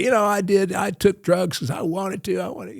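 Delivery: high, soft imitation of a cult leader; Charles Manson. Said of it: "Charles Manson would say".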